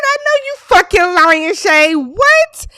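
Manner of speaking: high, loud squawk of disbelief; hammed-up outraged shout; shocked disbelief. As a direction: shriek it like scandalous gossip. Outraged reaction to the reveal.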